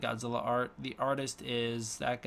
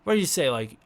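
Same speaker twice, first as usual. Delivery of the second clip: usual speaking voice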